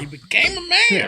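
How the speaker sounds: high hyped shout; excited. Real, not acted. Hyped celebration of friend.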